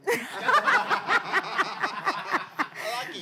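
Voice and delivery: high, loud belly laugh; long laughter. Real, not acted. Host joking with guest over language choice, laughing. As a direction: crack up at length.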